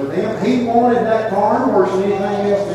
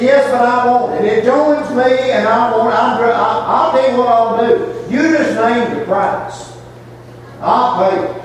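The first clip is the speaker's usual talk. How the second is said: high, pressing king voice; insistent king; 6 semitones higher than usual. Acted. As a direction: push hard like a greedy king. Ahab voiced pleading for farm.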